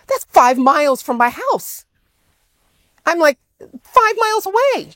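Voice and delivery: high, slow astonished cry; amazed disbelief. Retold or imitated. Speaker recalling hearing a speaker from his town, astonished. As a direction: act stunned by the coincidence. Shocked at the coincidence.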